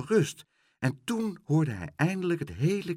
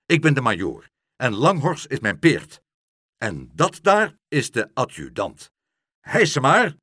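loud, hearty military voice; a hint of a commanding officer, louder; lightly voiced major; 3 semitones higher, 7 dB louder than usual. A narrator's reading. Major introducing himself to the boy, lightly authoritative.

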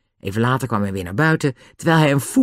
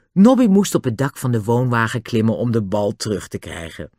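plain reading voice

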